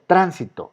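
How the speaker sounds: tránsito with TRÁN high; stress on first syllable. Shown as a demonstration. Teacher gives the intended tránsito, first syllable stressed. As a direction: lift the first syllable of tránsito, then fall. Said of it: "no es ni transito ni transitó".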